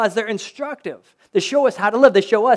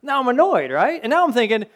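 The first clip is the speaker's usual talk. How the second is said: ordinary delivery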